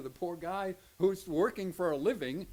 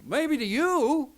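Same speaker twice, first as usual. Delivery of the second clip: loud, high and slow retort; preacher rebuking someone who thinks four bucks is nothing, slow loud retort; pointed retort; 8 semitones higher, 11 dB louder, much slower than usual. Real, not acted. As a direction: hit it back slowly and hard. Retorting to dismissive listener.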